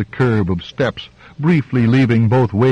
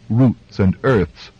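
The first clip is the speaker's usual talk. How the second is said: ordinary delivery, nothing marked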